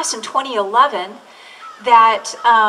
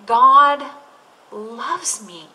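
savoured, hushed; much slower than usual. Acted. Savouring the line, preacher pause.

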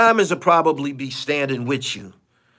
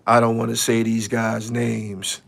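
mocking quote; 6 semitones lower than usual. Mimicking a man who won't name names, low mocking quote. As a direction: quote him in a low, subdued voice. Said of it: "Y'all talking about some".

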